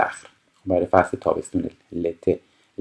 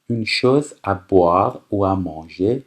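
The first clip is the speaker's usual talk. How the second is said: slow, careful French phrase; unhurried teacher reading; much slower than usual. Shown as a demonstration. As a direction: spell it out slowly for the class. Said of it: "teacher reads French slowly".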